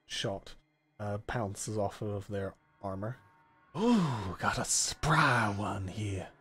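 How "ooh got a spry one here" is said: drawn-out, delighted drawl; folksy menacing stranger. Acted. Game master as stranger after being shot at, amused drawl. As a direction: swoop up on the first word, then drawl.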